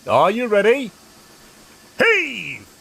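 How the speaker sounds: loud, high calling out; called out. A narrator's reading. Fat Controller calls to the engines, loud high call. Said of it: "called the Fat Controller".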